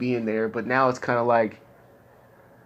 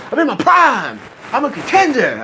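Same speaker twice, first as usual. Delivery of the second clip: much higher, louder chest-thumping boast; mock macho boast; 11 semitones higher, 7 dB louder than usual. Mocking gym bros, a loud cocky macho shout. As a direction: ham up a cocky fighter bragging. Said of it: "this whole testosterone, thoroughbred garbage".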